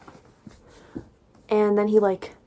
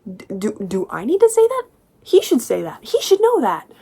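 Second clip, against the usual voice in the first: sharp high outburst; almost yelling in frustration; real exasperation; exasperated; 3 semitones higher, 4 dB louder than usual. Real, not acted. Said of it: "exasperated rant".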